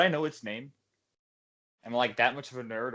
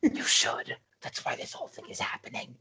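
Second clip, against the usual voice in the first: whispered retort; insistent whisper. Acted. Player argues with Eric about why it matters, whispering. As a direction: whisper back, pressing the point.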